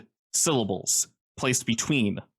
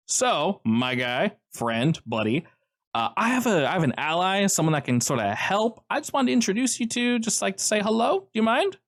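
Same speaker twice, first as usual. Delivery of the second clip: higher, eager friendly fixer; Joe's voice; 9 semitones higher than usual. Acted. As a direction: talk him up like an old buddy. GM voices Joe, higher pitch.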